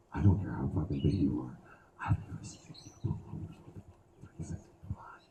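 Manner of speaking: fast hushed unvoiced whisper. Whispering a taunt to a crowd member. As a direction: hiss it quietly at him.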